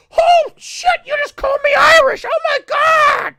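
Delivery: very high, screaming mock horror; hammed-up outraged shriek. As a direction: shriek it like the insult of a lifetime. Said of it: "British people go".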